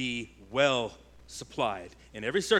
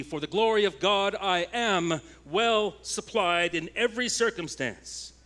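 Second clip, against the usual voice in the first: preaching at full force; 6 semitones higher, 4 dB louder than usual. Acted. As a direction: plant each word firmly, triumphant. Preaching the promise.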